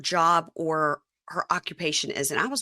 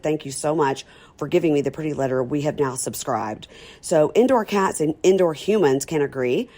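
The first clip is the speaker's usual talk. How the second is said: ad read; 2 semitones lower, 3 dB louder than usual. Acted. Sponsor ad read.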